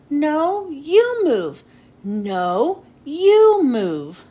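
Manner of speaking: stubborn arguing. Acted. Kids arguing over who moves.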